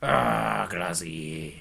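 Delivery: mock Scottish accent, dropping low at the end; Glasgow accent. Correcting his friend with a Scottish accent. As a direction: drawl it out like a Glaswegian. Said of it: "they say".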